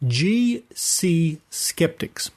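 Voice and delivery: slow, careful letter-by-letter spelling; spelled out slowly. Real, not acted. Host spelling out the Facebook address for listeners, slow and careful. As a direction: spell it out so listeners can type it.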